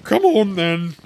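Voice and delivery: high, loud royal command; roar. A narrator's reading. Queen summons Alice to play, roaring. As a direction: roar it out impatiently. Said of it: "roared the Queen".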